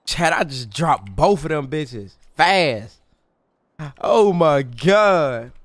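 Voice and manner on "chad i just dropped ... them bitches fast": ordinary talk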